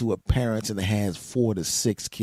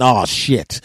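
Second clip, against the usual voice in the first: much louder sudden oh shit; shocked; 2 semitones higher, 11 dB louder than usual. Retold or imitated. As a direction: blurt it out at the discovery. Discovering glue traps on bed.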